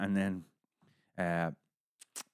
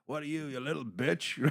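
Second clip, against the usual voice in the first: higher-pitched imitation of a heckling fighter; mock taunt; 6 semitones higher than usual. Fighter teasing guys who refuse tequila, mock taunt. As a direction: needle him, pitched up.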